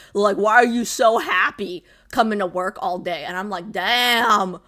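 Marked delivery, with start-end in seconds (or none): none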